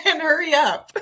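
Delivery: laughing. Retold or imitated. Adds kid's demand laughing.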